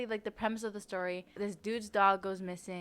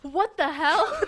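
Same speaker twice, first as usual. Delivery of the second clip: higher, louder shocked laugh-question; 7 semitones higher, 9 dB louder, slower than usual. Real, not acted. Friend hearing about drying a book in a microwave, shocked and loud. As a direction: blurt it out in disbelief.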